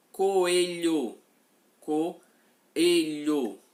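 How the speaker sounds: coelho said loud and clear so the separate vowels o and e are heard; loud demo word. Shown as a demonstration. Teacher showing the hiatus in coelho, loud and carefully spoken. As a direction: proclaim coelho to the class, vowels in separate syllables.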